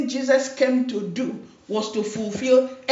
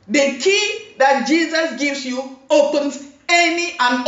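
preaching; 4 semitones higher, 4 dB louder than usual. Acted. Preaching with rising force.

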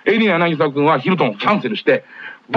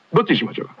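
ordinary talk

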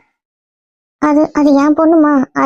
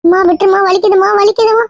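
pained pleading; 6 semitones higher than usual. Acted. Mother held and hurt, pleading.

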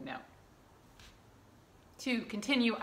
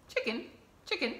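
ordinary delivery

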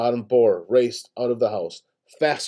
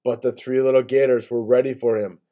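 usual speaking voice

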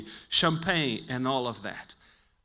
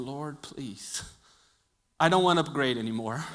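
mock muttered prayer; 7 semitones lower, 7 dB quieter than usual. Muttered prayer after the mishap.

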